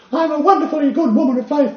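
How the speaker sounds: high, loud, prim posh English accent put on for the churchwoman. Churchwoman announcing her faith in a posh English accent. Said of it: "in that accent".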